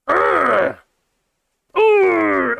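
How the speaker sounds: fed-up urgh, rising at the end; disgusted groan. Real, not acted. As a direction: groan like it physically pains you. Groaning at Brexit costs.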